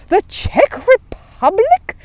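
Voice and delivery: high, loud, slow outrage; the bear hears his vehicle may be abroad, loud and shocked; shocked exclaim. Acted. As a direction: gasp it out, appalled. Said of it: "exclaimed Paddington hotly".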